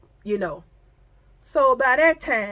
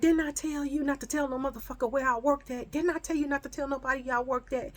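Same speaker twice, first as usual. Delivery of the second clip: rapid-fire scolding in a raised pitch; retold yelling; 5 semitones higher, 5 dB quieter, faster than usual. Friend on the phone to the one who told, mock yelling. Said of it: "All I hear is yelling".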